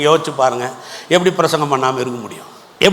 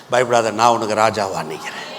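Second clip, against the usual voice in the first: low, soft divine promise; hushed words of assurance; calm reassurance; 6 semitones lower, 4 dB quieter than usual. Acted. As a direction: comfort them in a quiet, lowered voice. Voices God reassuring the believer.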